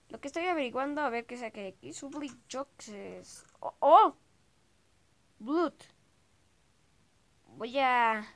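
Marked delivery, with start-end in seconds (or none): 3.6-5.7 oh agudo y muy fuerte; sobresalto. Real, not acted. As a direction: suelta la sorpresa de golpe. Sobresalto ante la sangre. (oh de sobresalto, agudo y fuerte)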